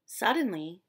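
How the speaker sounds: ordinary delivery, nothing marked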